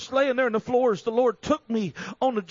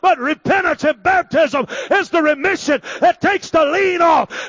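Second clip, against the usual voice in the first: loud, driving preacher's delivery; full-force preaching; 5 semitones higher, 10 dB louder than usual. Real, not acted. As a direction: build higher and louder with each phrase.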